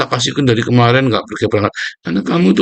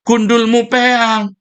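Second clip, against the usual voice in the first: high, slow, drawn-out jeer; mocking drawn-out jeer; mocking retort; 11 semitones higher, much slower than usual. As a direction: sneer it out long and high. Same retort earlier.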